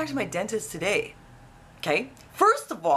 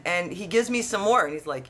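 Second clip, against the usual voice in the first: ordinary talk, nothing marked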